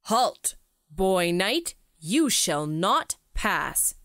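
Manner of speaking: stern guard. Acted. Cave guards block the knight.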